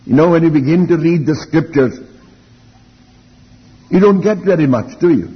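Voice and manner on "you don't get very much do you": ordinary talk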